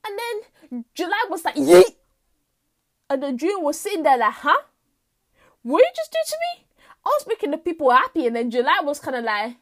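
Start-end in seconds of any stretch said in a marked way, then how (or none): 1.6-1.9 sudden loud yelped "yeet"; one short, explosive whoop; exuberant yell. Acted. July bursting in on June, exuberant yell. 4.3-8.2 high, fast, louder protest; taken aback, fire back in disbelief; indignant disbelief. Acted. June confronting July who barged in, indignant disbelief.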